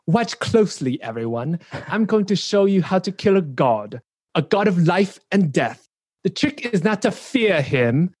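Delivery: cool, commanding English villain voice; English accent. Retold or imitated. Quoting Lady Eboshi to the panel in an English accent. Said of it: "That was my best English, uh, English accent".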